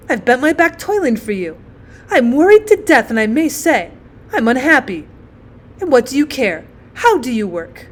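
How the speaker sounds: self-pitying mother's scolding; reproachful mother. Acted. Worn-out mother berates her son for failing, aggrieved.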